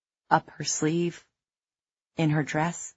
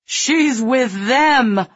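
loud, high, very slow cry; woman screaming a warning; desperate slow shout; 8 semitones higher, 6 dB louder, much slower than usual. A narrator's reading.

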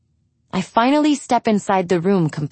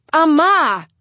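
high, raised-voice call; yell; 9 semitones higher, 4 dB louder than usual. A narrator's reading. Daughter trying to wake her mother, yelling. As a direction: call out sharply, pitched well up. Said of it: "I finally yell".

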